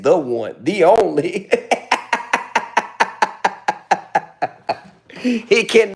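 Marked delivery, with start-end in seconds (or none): 1.6-4.5 laughing. Real, not acted. Breaks up laughing.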